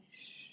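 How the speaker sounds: long, soft "shh"; soft shushing. Acted. Paul hushing the noisy crowd, soft shushing. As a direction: gently hush a crowd.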